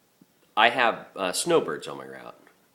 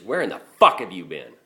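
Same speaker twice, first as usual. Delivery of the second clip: louder, angry demand; 2 semitones higher, 9 dB louder than usual. Retold or imitated. Furious customer to her garbage man: loud angry demand. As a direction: bark the question like a furious customer.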